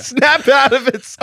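loud, high, slow mock command; hammed-up vintage film outburst; emphatic old-movie line. Shown as a demonstration. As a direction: order it like an old movie star. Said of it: "with the emphasis".

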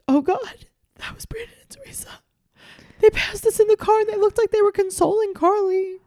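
high, squeaky fake-crying voice; mock crying. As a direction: imitate a man blubbering in a thin, high whine. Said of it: "he stops and he starts crying".

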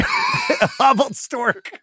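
high-pitched mock impression, stretched out; Verno. Host echoing co-host's tweet reading, mock Verno voice. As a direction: echo the silly imitation in a high, slow voice. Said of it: "Read it in the Verno voice".

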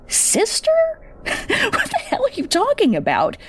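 high, airy scoffing laugh through the words; incredulous laugh. Acted. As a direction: laugh her off in disbelief. Daughter scoffs at mother's claim.

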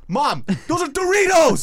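loud, high exasperated yell; screaming son. Retold or imitated. Son correcting mom at the bag of chips, screaming. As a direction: yell the correction slowly, imitating an incredulous son. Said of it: "they're screaming at each other".

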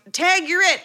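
high-pitched mock yell; light, high retold shout; imitated kid's yell. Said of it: "his brother yells".

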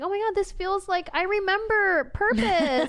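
high, loud emotional outburst. Retold or imitated. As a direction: voice soaring with overwhelmed feeling. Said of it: "it's been very emotional feeling".

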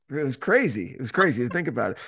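ordinary delivery

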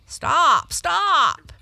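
high, loud alarmed shouts; excited cry. Acted. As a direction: cry out to halt her at once. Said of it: "cried the frog, hopping about excitedly".

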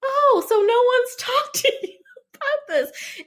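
high, slow, laughing disbelief; surprised resident. Retold or imitated. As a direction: laugh through the question.

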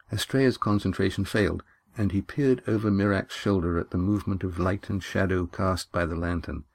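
plain reading voice

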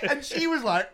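ordinary delivery

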